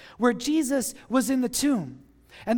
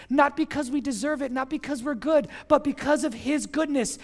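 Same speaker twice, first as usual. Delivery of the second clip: ordinary delivery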